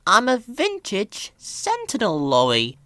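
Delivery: put-on high, condescending old lorry voice; haughty old steam lorry; Elizabeth. A narrator's reading. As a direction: look down on the little puffer. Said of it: "replied Elizabeth".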